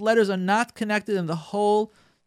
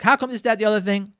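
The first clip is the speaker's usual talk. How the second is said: higher, louder accusing husband; a hint of a grumpy husband; mock irritated husband; 5 semitones higher, 5 dB louder than usual. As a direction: play the irritated husband's attack. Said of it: "And he's like".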